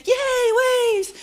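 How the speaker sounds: high, sing-song cheer; mock cheer. Family jumping waves in the ocean, mock-cheerful and high. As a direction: squeal it like a kid at the beach.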